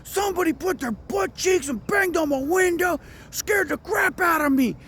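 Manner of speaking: retelling a scared friend's shouting over the phone; frantic shout. Retold or imitated. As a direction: yell like someone who just got scared half to death. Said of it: "He's like".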